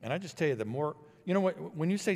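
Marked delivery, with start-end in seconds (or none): none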